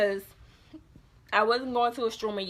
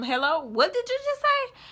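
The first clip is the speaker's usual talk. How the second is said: high, incredulous "what did you just say"; shocked question; 11 semitones higher than usual. Retold or imitated. Hearing the girlfriend claim him, high shocked question. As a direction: jump the pitch way up in disbelief. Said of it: "I was like".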